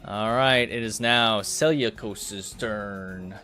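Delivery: usual speaking voice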